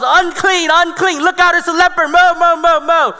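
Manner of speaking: high, loud rapid-fire alarm; panicked crowd yelling. Acted. Crowd spotting a leper among them, yelling in alarm. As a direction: shout warnings over each other. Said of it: "People are yelling all across".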